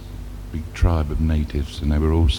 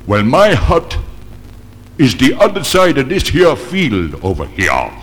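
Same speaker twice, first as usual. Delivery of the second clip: higher, knowing punchline voice; the chief springs the joke; chief's voice; 8 semitones higher than usual. Acted.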